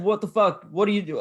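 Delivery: high, mock-aggressive outburst; retold confrontation. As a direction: imitate an aggressor getting in your face. Retold stranger confronting him up close.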